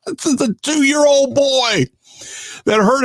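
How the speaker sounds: high-pitched, drawn-out marveling; real amazement. Real, not acted. Host marveling at a toddler's taste in radio, amazed. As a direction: exclaim it slowly, way up high, amazed.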